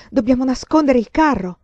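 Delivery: urgent alarm. A narrator's reading. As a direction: raise the alarm to a companion. Allarme improvviso. (esclamazione allarmata e urgente)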